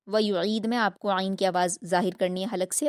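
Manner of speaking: ordinary delivery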